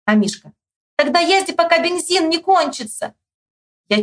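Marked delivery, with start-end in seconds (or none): 1.0-3.1 high-pitched call; high narrated shout; shouted advice. A narrator's reading. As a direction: holler it to the rider. Boys shouting across yard.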